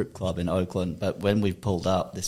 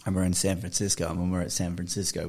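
normal talking voice

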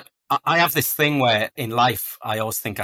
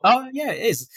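pitched way up in giddy disbelief; high, thrilled confirmation, half-laughing; retold excited surprise; 13 semitones higher, 3 dB louder than usual. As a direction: squeal it like you can't believe your eyes. Confirming [name] sighting, excited.

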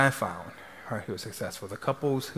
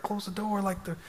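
retold scolding, pitched up without real volume; mock scream; 8 semitones higher than usual. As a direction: imitate an upset husband scolding in a high voice. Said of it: "he's screaming at her".

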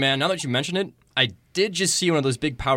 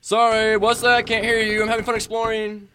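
mocking; 6 semitones higher than usual. Taunting, pretending not to hear.

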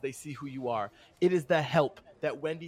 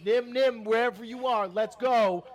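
raised, higher calling voice; 6 semitones higher, 5 dB louder than usual. Acted. Fez calling hidden Nim to join the chase, loud and high. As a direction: call loudly to someone out of sight.

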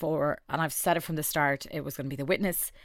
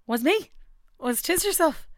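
excited; 13 semitones higher, 4 dB louder than usual. Real, not acted. Announcing her own surprise win.

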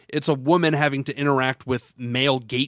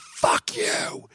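drawn-out, strangled scream without real volume; mock scream. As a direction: stretch both words into an imitated scream. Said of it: "she's screaming, like, from behind the door".

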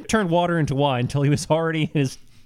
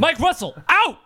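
higher, announcing the name like a mic drop; mock announcer call; 11 semitones higher, 3 dB louder than usual. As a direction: call it out like a big exit line.

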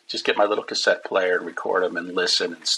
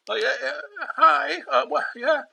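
high flustered squeak; squeaky teen voice. Retold or imitated. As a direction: stammer like a starstruck teen. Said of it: "seventeen-year-old squeaky voice".

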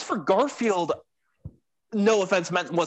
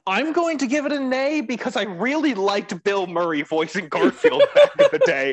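laughing; 7 semitones higher than usual. Real, not acted. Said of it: "laughs mid sentence".

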